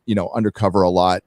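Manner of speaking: ordinary talk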